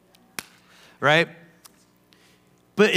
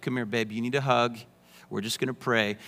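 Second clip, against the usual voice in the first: tender comfort; 7 semitones lower, 7 dB quieter, faster than usual. Retold or imitated. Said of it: "I'm like".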